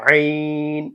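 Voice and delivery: slow আইন with throat pressure; pressed ain. Shown as a demonstration. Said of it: "pressure create করে একটু চাপ দিই". (চাপ দিয়ে ধীরে আইন)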